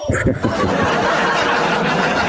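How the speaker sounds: high breathy mocking laugh; a derisive cackle between jabs; scornful laugh. Real, not acted. Mocking laugh mid-argument.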